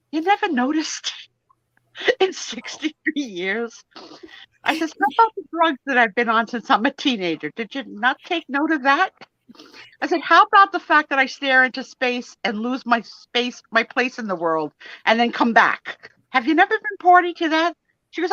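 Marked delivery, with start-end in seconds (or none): 0.1-3.8 high, disbelieving retort; mock amazement at her, laughing through it; laughing disbelief; incredulous. 5.0-17.7 higher, pressing rhetorical questions; retold exasperation at sister; exasperated.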